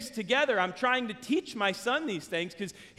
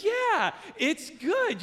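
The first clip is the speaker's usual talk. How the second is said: put-on high, drawn-out kid's admission; mock reluctant approval; imitated son conceding; 10 semitones higher, much slower than usual. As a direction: stretch it out in a high child's voice. Son grudgingly admits he likes it.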